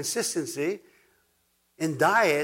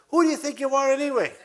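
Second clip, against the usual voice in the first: high, fast, laughing mock outrage; indignant retort; 11 semitones higher, faster than usual. Church member snapping back at Pastor Ken, mock indignant. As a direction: play the offended member huffing back.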